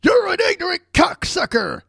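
hammed-up high shout of abuse; mock online shout. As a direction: yell like an angry forum troll. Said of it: "calling the other guy".

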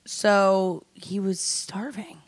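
slow hushed whisper. Pretend. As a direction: breathe it out, slow and secret. Dramatic whisper about starving.

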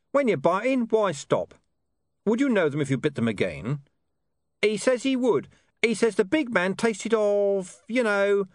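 high, gruff dog voice with a knowing air; a streetwise dog explaining things. Acted. Deep Bone speaking.

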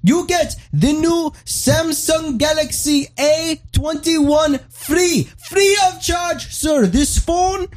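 very high, slow, hammed-up Indian accent; pushy Indian salesman. Salesman pushes the free Samsung, in a mock Indian accent. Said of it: "and he goes".

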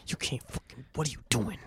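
very soft, tense mock protest; hushed confrontation. Confronting the friend at dinner, hushed protest. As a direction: protest under your breath, barely voiced.

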